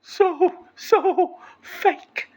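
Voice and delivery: very high put-on falsetto. Shown as a demonstration. Speaker telling viewers it sounds so weird, falsetto. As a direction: keep talking in a silly, fake high voice. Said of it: "if this voice makes me feel".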